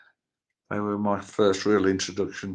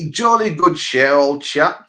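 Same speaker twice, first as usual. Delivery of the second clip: loud, higher, hammed-up British; best British accent; 5 semitones higher, 10 dB louder than usual. Shown as a demonstration. Host demonstrating accents for fun, in hammed-up British. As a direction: put on a hearty mock-British voice. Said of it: "your best British".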